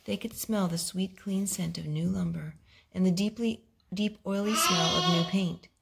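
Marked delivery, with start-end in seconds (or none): none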